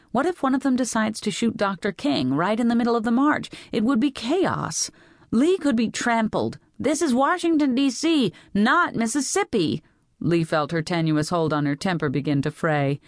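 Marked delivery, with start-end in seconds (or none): 0.1-6.6 higher, urgent worry; anxious warning. A narrator's reading. Mother fearing for daughter at the march, anxious warning. 6.8-9.8 put-on high, emphatic teenage protest; exasperated rebuttal. A narrator's reading. Teenager rebutting mother's fears of violence, high and exasperated.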